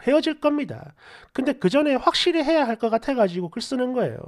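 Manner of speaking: animated story-channel narration; story read-aloud. Acted. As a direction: read out the aggrieved writer's story. Reads a submitted story aloud.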